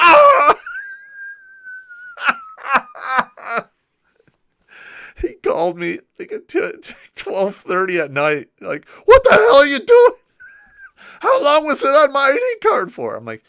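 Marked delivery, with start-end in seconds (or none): 0.0-0.6 sudden high, loud yelp of shock. Retold or imitated. Friend spotting the prank note on his ID card, shocked yelp. 0.6-3.6 real, high, helpless cackle; high cackling laughter. Real, not acted. Streamer cracking up retelling his ID card prank, high cackling laughter. 9.0-13.2 high, loud outraged yell; hammed-up furious friend on the phone; furious shout. Angry soldier calling at midnight about the prank, high loud yell.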